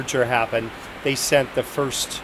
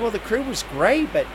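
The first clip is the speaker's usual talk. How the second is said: put-on bubbly young attendant; 7 semitones higher than usual. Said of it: "She said".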